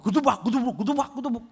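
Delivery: high, pounding nonsense rhythm; mock song beat. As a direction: imitate a thumping pop track. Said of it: "Orang lagunya".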